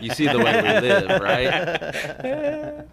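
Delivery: high, loud words through laughter; laughing. Real, not acted. Host jokingly shows off their lifestyle to listeners, laughing. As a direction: crack up while speaking, pitch climbing.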